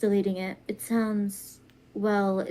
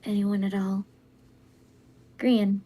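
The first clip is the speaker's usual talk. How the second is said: usual speaking voice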